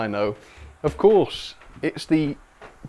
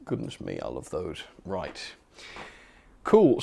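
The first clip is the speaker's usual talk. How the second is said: ordinary talk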